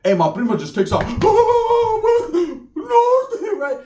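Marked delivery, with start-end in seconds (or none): 1.1-3.6 much higher fleeing shriek; high mock wail. Primo running from pit bulls, shrieking. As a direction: cry out like you are bolting from dogs.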